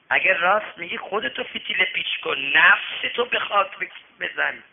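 ordinary talk, nothing marked

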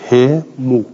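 "ه مو" split out as a loud "he, mu"; kasra into damma. Shown as a demonstration. Teacher isolating the heavy syllables, "ه مو" loud as "he mu". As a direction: hit both syllables of "ه مو", i-vowel then u. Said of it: "از کسره بریم رو ضمه".

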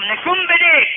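loud, high acted order; sultan's shouted order; insistent command. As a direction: demand it, raising the voice sharply. Sultan repeats his refused order.

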